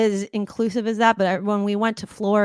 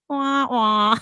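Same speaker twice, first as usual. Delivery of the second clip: 1 sad trombone; 3 semitones higher, 4 dB louder than usual. Retold or imitated. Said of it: "the sound effect".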